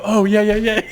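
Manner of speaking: embarrassed yeah-yeah, high and laughing; sheepish laughing. Retold or imitated. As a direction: laugh it off, caught out. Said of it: "and I go".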